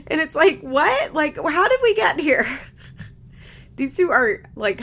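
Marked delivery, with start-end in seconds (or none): 0.1-2.5 higher and louder, baffled disbelief; how did we get here, incredulous. Real, not acted. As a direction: ask it like you can't believe it. Disbelief at the drama.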